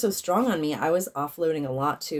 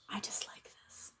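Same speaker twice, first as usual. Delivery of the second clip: whisper; 18 dB quieter than usual. Real, not acted. Reacting to a card.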